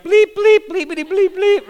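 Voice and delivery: high, loud bleeped cursing rant; mock Boston cursing. Pastor mimics Boston locals cursing, high and loud. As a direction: squeal out a censored tirade. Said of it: "they're like".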